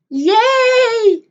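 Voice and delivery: high, loud little yay; squealed yay; excited cheer. Real, not acted. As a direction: cheer with delight. Cheering viewers arriving.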